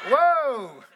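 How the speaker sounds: sudden loud high shout; loud whoa. Real, not acted. Preacher waking dozing listeners with a loud shout. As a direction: jolt the room awake.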